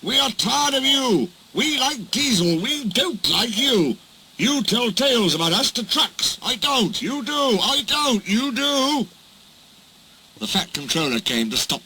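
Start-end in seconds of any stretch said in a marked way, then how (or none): 0.0-9.1 squabbling. Acted. Engines quarrelling with Duck.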